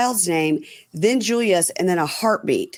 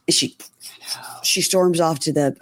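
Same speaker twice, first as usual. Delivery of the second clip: ordinary delivery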